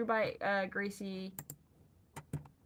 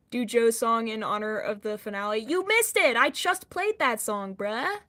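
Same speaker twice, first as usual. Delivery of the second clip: shouted out, much louder; loud indignant shout; loud exclamation; 2 semitones lower, 12 dB louder than usual. Real, not acted. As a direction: call it out loud in disbelief. Chat request, streamer exclaims.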